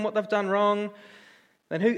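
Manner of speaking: ordinary talk, nothing marked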